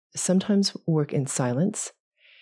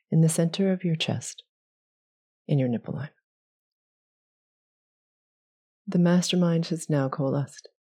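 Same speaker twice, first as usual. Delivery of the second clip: guided meditation; 4 semitones higher than usual. Acted. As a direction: ease them through each breath. Leads a meditation.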